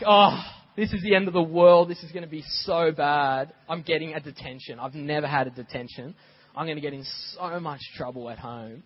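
put-on loud, distraught child, fading down; half-hammed wail of a kid in trouble; boy sure his world is ending over detention; mock bawling kid. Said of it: "I'm there bawling, I'm crying".

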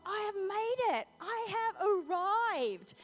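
high, grand self-congratulation; mock triumph. Peter thinks he has arrived, mock triumphant. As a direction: proclaim it slowly, puffed up with importance.

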